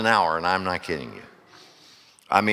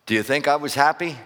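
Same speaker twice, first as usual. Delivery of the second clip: ordinary delivery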